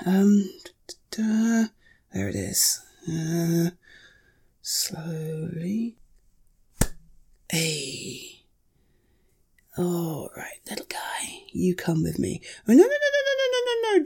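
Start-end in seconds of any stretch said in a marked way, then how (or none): 0.1-11.4 soft unvoiced whisper while creeping up; careful whisper. Sneaking up on the spider. 12.7-14.0 sudden much higher burst of alarmed noes; startled no-no-no. Real, not acted. Spider crawling out of hands.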